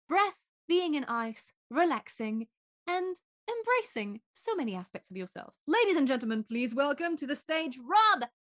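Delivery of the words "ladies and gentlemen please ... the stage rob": showman intro building to a loud name; mock announcer. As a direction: announce the guest like a stage host, punching the name. Introducing the guest on podcast.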